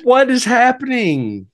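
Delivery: high, drawn-out incredulous cry; baffled disbelief. Real, not acted. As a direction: exclaim slowly in total bewilderment. Baffled reaction to absurd elephant plot.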